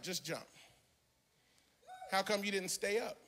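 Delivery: ordinary talk, nothing marked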